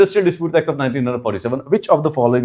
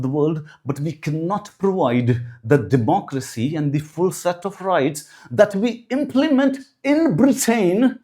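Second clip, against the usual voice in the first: slow, higher mock British accent; slow, lofty put-on British accent with drawn-out lines; fake British accent; 4 semitones higher, slower than usual. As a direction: ham up a pompous British colonial gentleman accent. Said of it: "let me get my British accent back".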